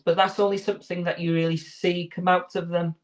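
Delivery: ordinary talk, nothing marked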